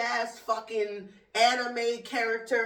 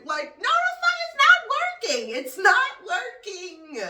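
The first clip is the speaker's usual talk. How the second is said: higher, louder, exasperated yell; 11 semitones higher, 6 dB louder than usual. Real, not acted. Yelling at the airline that the tape fails. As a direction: shout it like the tape just gave out.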